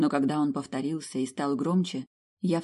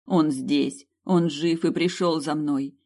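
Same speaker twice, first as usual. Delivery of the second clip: louder, slower cry of joy; joyful outburst; 5 dB louder, slower than usual. Acted. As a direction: nearly shout it out with relief. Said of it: "едва не закричала от радости".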